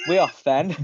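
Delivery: high, loud cheery voice for Andrew; chirpy character voice breaking into the story; Andrew's line. Acted. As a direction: call it out brightly as Andrew.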